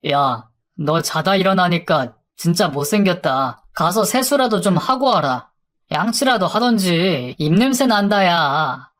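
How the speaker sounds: plain reading voice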